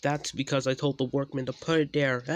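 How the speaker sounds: fast, high, loud gloat; gleeful troublemaker's boast. A narrator's reading. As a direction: crow over your trick, quick and loud. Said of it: "laughed Anti".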